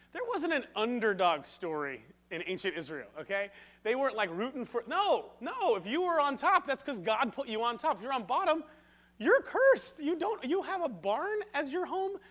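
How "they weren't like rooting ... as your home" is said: mock scornful voice. Mocking ancient attitude, high voice.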